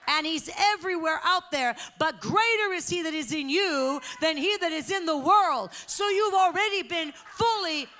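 building to loud, high, driving sermon delivery; full-force preaching. Real, not acted. Preacher telling the church how God teaches them, fervent. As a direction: preach with rising force to a ringing climax.

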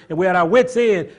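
normal talking voice

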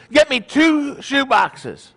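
high, slow, deliberate order; fatherly command. Acted. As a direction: play a father giving his son instructions. Joke father orders his son.

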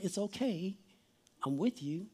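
put-on soft, low reassurance to a child; quiet, calming parent voice; gentle parent soothing. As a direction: hush and settle an upset child tenderly. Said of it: "grabbed your child's face very gently".

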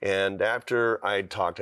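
ordinary talk, nothing marked

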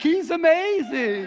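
a hammed-up gushing congregation; mock adoring crowd. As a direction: gush like starstruck fans. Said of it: "with a stupid accent".

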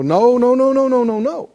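high, loud cries of no; imitating people screaming no; mock scream. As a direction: mimic a panicked church crowd. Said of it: "would scream and go".

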